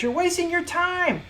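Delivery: high-pitched mocking quote; imitated scolding sister. Imitating sister's scornful remark.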